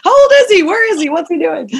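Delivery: high, loud frantic questions; mock panic. Mother startled by how big her son got, frantic high questions. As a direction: fire off the questions in a frazzled rush. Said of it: "I'm like".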